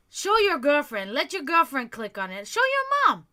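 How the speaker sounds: indignant, scolding dare; angry taunt. Real, not acted. Angry taunt at the absent sender.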